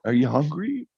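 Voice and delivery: a touch of wary hesitation on "Are you hungry?"; a little tentative. Retold or imitated. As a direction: ask "Are you hungry?" carefully, half afraid of the answer. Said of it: "She's like, a little tentative".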